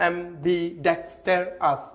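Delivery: ambidextrous slowly, in separated syllables; broken up. Shown as a demonstration. Teacher showing a hard word, slowly broken up. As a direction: pause between am-bi, dex, ter and ous in ambidextrous. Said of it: "ভেঙে ভেঙে যদি উচ্চারণ".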